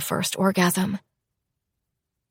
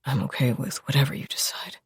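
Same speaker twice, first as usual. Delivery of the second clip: quick, soft whisper; hushed whisper; 4 semitones lower, 6 dB quieter, faster than usual. Acted. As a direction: rush it under your breath. Aroused lover whispering.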